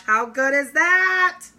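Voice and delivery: high, loud, slow delight; excited. Real, not acted. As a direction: savor it with joy. Delighted at poured coffee.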